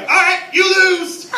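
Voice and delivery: loud, high, drawn-out announcement; mock triumphant shout. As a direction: call the game over in an exaggerated yell. Acts out calling the game lost.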